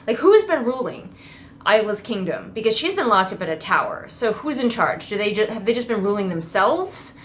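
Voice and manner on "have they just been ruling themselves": ordinary talk, nothing marked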